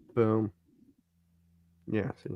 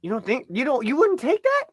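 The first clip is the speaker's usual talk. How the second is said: incredulous question; 9 semitones higher than usual. Real, not acted. Co-host questioning friend who won't take the trade, incredulous. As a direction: pitch climbing very high in disbelief.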